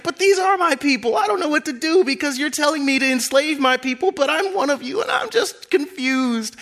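high, pleading and torn; confused plea. Acted. Moses pleading with Pharaoh, high and distressed. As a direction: beg Pharaoh to understand.

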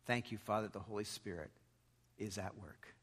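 soft prayer fading away; quiet prayer. Acted. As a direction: pray softly, letting it fade.

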